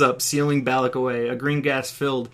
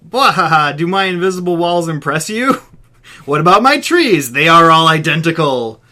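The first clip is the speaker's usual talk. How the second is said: gloating villain; 3 semitones higher, 8 dB louder than usual. Acted. Villain taunting trapped Balak.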